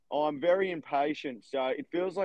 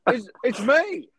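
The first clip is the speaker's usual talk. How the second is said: high, louder acted excitement; excited reply; 10 semitones higher, 5 dB louder than usual. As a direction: eagerly announce yourself, pitched high. Reenacting excited reply to caller.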